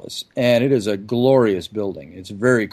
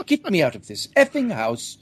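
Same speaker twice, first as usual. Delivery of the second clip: mock-British yelp, higher pitched; British accent; 5 semitones higher than usual. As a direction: imitate a Brit begging to escape, in British accent. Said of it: "only he said it more British".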